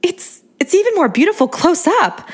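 high gushing praise. A narrator's reading. Admiring the pink Corvette, a high gushing voice. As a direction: gush over the car like a fan.

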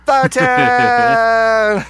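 high, loud hype call; hype shout. Pretend. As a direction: announce it like a hype man. Announcing segment.

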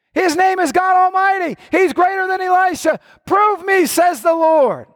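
a preacher pleading at full force; full-force preaching. Acted. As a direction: lift them up to the promise.

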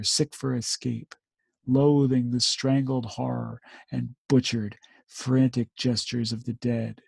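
solemn war poem recital; poem reading. Acted. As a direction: read the verse with grave weight.

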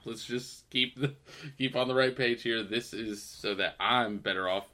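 soft, slow self-justifying murmur; a touch of hushed sanctimony in the meeting; smug quiet. Retold or imitated. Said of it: "He's like".

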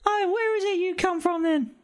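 Cockney crow, high and laughing; outraged Cockney bird, cracking up; Cockney accent. Acted. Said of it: "my Cockney accent".

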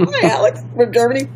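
laughing through a teasing echo; amused echo of the German visitor; laughing reply. Retold or imitated. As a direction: chuckle as you parrot his greeting back.

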